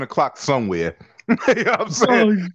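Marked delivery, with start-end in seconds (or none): 1.3-2.5 laughing. Real, not acted. Laughing after drinking joke.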